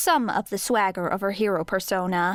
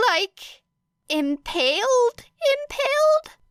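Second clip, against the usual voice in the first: much higher, rising incredulous impaled; shocked disbelief; 3 dB louder, slower than usual. Acted. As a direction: squeak the repeat in horror.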